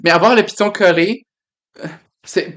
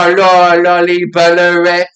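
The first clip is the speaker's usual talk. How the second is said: put-on loud, slow, over-the-top Saguenay accent; 7 dB louder, slower than usual. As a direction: ham up the Saguenay accent on "Bollorais". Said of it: "avoir l'accent saguenayen".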